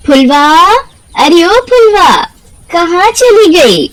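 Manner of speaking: high, slow calling through the house. Acted. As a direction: call for the missing daughter. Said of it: "क्यों चिल्ला रही हो". (माँ बेटी को पुकारती, ऊँची धीमी पुकार)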